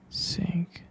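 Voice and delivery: usual speaking voice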